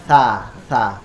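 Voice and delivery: tongue out between the teeth for "sa"; "Sa" with tongue tip showing past the teeth; demo of sa. Shown as a demonstration. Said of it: "Jadi lidah kita keluar". ("sa" dengan lidah keluar sedikit)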